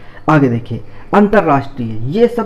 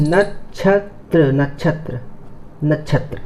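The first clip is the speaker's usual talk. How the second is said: slow careful word; 4 dB quieter, slower than usual. Shown as a demonstration. Teacher spelling for students, very slow. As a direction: draw नक्षत्र out very slowly. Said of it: "इसको देखते हैं".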